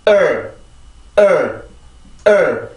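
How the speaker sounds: "을" as a stressed r-coloured er; tense the vocal cords on each isolated "을"; stressed "을" vowel. Shown as a demonstration. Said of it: "성대만 긴장을 시켜주면 되죠".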